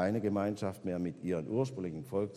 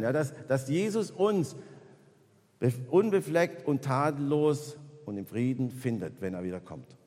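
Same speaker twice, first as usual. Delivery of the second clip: higher, louder fervent preaching; full-force preaching; 8 semitones higher, 7 dB louder than usual. Acted. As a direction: drive the point home like a preacher at full force. Preaches the second point forcefully.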